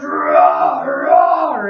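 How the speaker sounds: loud roaring dragon; the dragon roaring inside his cave. Acted. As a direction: roar it out big. Dragon roars.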